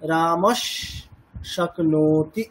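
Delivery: রামস শক্নোতি with visarga turned into a long sh sound; sandhi pronunciation demo. Shown as a demonstration. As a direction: say রামস শক্নোতি joining the words. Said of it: "প্রোনাউন্সিয়েশনটা কেমন হবে?".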